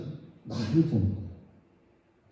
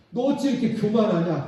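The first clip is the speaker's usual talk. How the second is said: stern rebuke; 7 semitones higher, 3 dB louder than usual. Acted. God rebuking the sinner.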